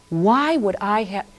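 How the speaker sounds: usual speaking voice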